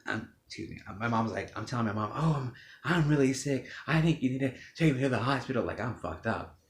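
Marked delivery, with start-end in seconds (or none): 2.1-5.6 higher, louder, rushed plea; a sick son begging his mom for help; strained, urgent pleading. Retold or imitated.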